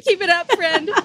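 laughing. Real, not acted. Encouraging while laughing.

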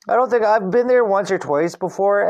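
normal talking voice

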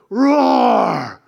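loud roar like a little lion; high, loud childish roar; kid roaring. Retold or imitated. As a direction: roar it out as a kid playing a bear. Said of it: "he would go".

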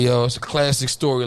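usual speaking voice

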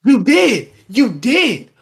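hammering the point home; angry retort. Real, not acted. Podcast host snapping back at the apology, heated.

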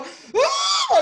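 long high mock scream; drawn-out comic shriek with laughter; grito exasperado. Su reacción al oírlo otra vez. (grito largo, agudo y teatral)

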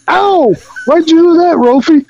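high, whiny sidekick voice; Honeymooners impression. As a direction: play the put-upon pal, pleading. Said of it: "He's a honeymooner".

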